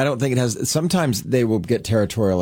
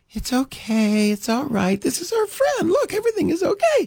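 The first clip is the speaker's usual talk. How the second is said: coddling owner. Retold or imitated. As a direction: mimic an owner cooing at an anxious dog. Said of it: "all of that sounds like".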